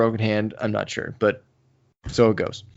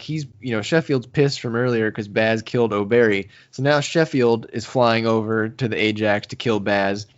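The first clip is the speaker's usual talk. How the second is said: usual speaking voice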